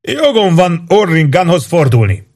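high-pitched, aggrieved objection; indignant protest. A narrator's reading. Demoted officer threatens an appeal to commander, indignant.

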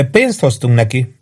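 ordinary delivery, nothing marked

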